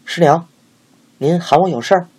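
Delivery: a hint of a nervous apprentice; timid boy answering. A narrator's reading. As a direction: apprentice answering his master's wife, lightly voiced.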